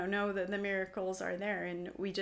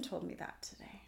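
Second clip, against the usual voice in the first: put-on soft whispered child line; 2 semitones lower, 9 dB quieter than usual. Little daughter telling mom where she heard it, whispered. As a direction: breathe it like a little secret. Child's secret about dead uncle.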